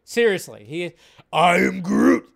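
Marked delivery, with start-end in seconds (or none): 1.3-2.3 loud creature catchphrase, last word pushed up; big, exaggerated creature voice; Groot. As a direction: boom it out as a mocking impression. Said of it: "he just says it in".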